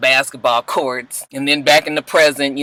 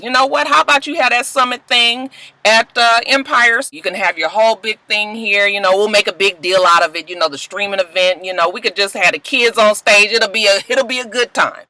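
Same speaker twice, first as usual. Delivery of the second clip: raised, salesy pitch voice; Lucius pitching; 6 semitones higher than usual. Retold or imitated. Lucius pitching the summit to the councilman, salesy. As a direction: sell it smooth and eager.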